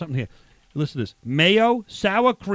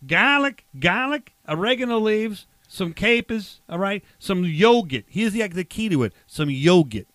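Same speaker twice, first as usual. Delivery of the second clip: put-on emphatic Boston accent, r's dropped; 3 semitones higher, 4 dB louder, slower than usual. Barbecue guy listing ingredients for co-host, Boston accent. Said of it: "use a Boston accent".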